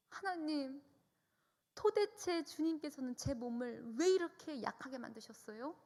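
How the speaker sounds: retold tearful complaint, pitched high; aggrieved lament. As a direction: plead upward to God, hurt and indignant. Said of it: "서럽고 억울하고 슬픈 마음이 범벅이 돼서".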